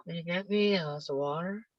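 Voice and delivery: soft, fast, slurred mumble; mumbling teenager. Retold or imitated. Teen mumbling a request to mom, slurred and soft. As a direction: swallow the words like a sulky teen. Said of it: "in this very low, slow way".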